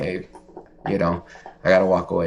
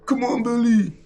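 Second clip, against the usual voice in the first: put-on squeaky baby talk, much higher; funny voice for dog. Owner coaxing her dog Belly, squeaky baby-talk voice. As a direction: coax the dog in a silly high voice. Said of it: "when I do funny voices".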